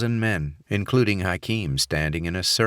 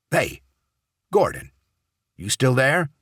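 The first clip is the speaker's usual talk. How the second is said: raised, higher voice checking he's there; calling for attention; 8 semitones higher, 4 dB louder than usual. A narrator's reading. Contact checks whether Gordon is still on the line, calling out. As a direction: call out to someone gone silent on the line. Contact calls to silent man on phone.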